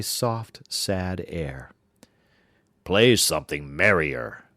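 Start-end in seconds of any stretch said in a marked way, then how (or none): 2.8-4.4 louder, lower demanding giant. Acted. Giant demands a merrier tune from the harp. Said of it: "said the giant".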